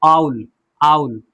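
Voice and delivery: आउल low and slow, with an "ow" glide; correct pronunciation. Shown as a demonstration. Teacher answers own question, आउल said with "au". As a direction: open आउल on "au". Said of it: "तो क्या पढ़ेंगे इसको?".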